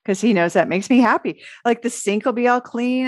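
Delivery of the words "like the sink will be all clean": ordinary delivery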